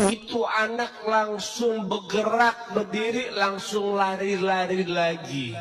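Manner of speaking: drawn out, savoring each word; slow dramatic retelling. A narrator's reading. Preacher retells to the congregation how the child rose, slow and awed. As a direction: linger on the miracle with awe.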